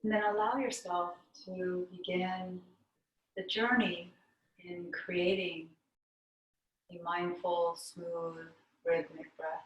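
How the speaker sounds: calm meditation teacher with long pauses; guided meditation. Real, not acted. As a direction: guide them inward, unhurried, leaving long silences between phrases.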